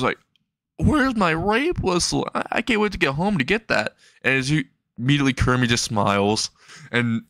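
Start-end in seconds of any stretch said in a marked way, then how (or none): 0.8-4.0 high panicked voice. Acted. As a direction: whine it out in alarm. Izuku horrified.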